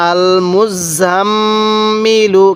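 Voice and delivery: "আল মুজাম্মিলু" very slow, double m hummed long and level; ghunnah. Shown as a demonstration. Teacher modelling the whole word for learners, long nasal hold. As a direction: hold the nasal mm in "আল মুজাম্মিলু", then release into "ilu". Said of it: "মিমে নুনে তাসদিদ গুনাহ করা ওয়াজিব".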